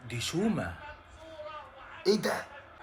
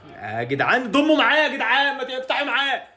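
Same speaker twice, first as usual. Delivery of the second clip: louder, higher call to the players; rally them from the couch; urging shout; 8 semitones higher, 5 dB louder than usual. Real, not acted. Fan urging his team to press, loud urging call.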